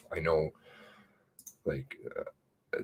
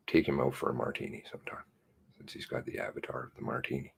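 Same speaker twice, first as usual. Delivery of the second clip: whispered aside; 5 semitones lower, 9 dB quieter, faster than usual. Real, not acted.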